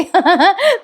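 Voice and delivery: short real laugh; a quick amused laugh. Real, not acted. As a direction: chuckle at poor Ashwin. Laughs about Ashwin.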